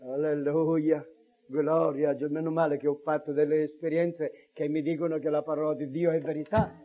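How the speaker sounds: ordinary delivery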